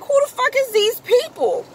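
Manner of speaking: high, incredulous who the fuck; throwing the question up in disbelief; retold disbelief.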